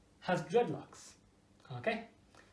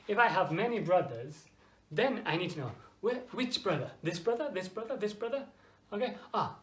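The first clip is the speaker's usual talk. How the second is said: usual speaking voice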